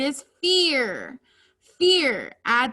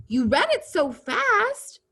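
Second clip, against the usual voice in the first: high, lively storybook dialogue; animated read-aloud exclamation; exclamation energy; 7 semitones higher than usual. Shown as a demonstration. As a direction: read it dramatically, with raised pitch. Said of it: "when there's an exclamation point".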